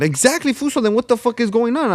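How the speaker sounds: high, indignant comeback; talking back to the cop, indignant; indignant retort. Retold or imitated.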